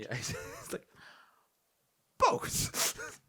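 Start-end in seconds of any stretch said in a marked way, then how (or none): 2.2-3.2 high, breathy laughing both; sheepish laughing answer. Retold or imitated. Caught out mimicking accents, laughing sheepishly.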